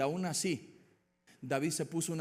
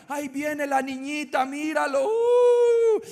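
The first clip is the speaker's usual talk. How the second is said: mocking soldiers; 11 semitones higher, 9 dB louder, slower than usual. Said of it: "Yo me imagino".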